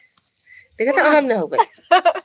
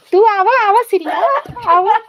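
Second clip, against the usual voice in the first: calling out; 9 semitones higher, 4 dB louder than usual. Real, not acted. Calling the girl over.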